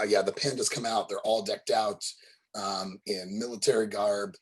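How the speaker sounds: ordinary talk, nothing marked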